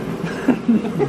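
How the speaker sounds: real laugh breaking out, bright and loud; burst of laughter. Real, not acted. Laughing off a friend's teasing about his gas station outfit.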